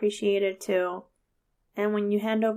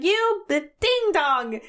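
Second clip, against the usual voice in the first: slow, higher mock insult; hammed-up scolding of another driver; road rage; 6 semitones higher, much slower than usual. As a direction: drag out a cleaned-up curse. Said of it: "like, road rage, right?".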